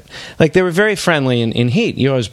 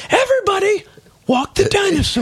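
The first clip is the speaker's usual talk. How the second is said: much higher, sing-song command; silly high voice. Acted. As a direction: chirp the line way up high. Repeating robber command.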